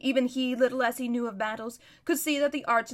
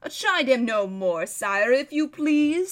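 slow, louder courtly plea; pleading courtier; 5 dB louder, slower than usual. A narrator's reading. Lord asking the king to stop chiding, slow appeal. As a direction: draw it out as a respectful appeal.

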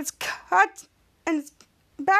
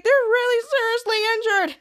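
high-pitched retold exclamation; amused exclamation; 7 semitones higher than usual. Henry on the fallen villains, high exclamation. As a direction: lift the voice up high. Laughing over the injured villains.

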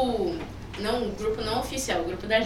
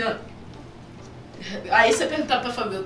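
ordinary talk

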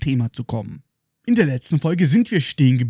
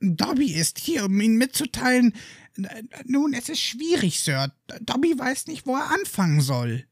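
high, soft, halting elf voice; Dobby stammering, soft and high; 8 semitones higher, 5 dB quieter than usual. Acted. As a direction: stammer it out like a nervous little elf. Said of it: "beginnt Dobby mit ernster Miene zu stammeln".

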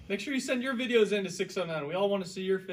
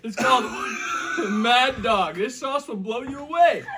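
loud, high, laughing shout; shouting through the burn; 4 semitones higher, 6 dB louder than usual. Real, not acted. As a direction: yell it while your mouth burns. Burning from hot sauce.

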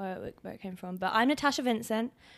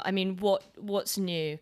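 ordinary talk

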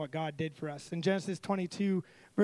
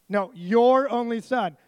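louder and slow, each word pressed; insistent God; 3 semitones higher, 8 dB louder, slower than usual. Retold or imitated. God insisting to Abraham, slow and loud. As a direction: correct him firmly, spelling it out. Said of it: "He's like".

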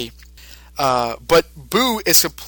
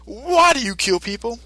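careful question; 8 semitones higher than usual. Retold or imitated. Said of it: "Mister Satan says".

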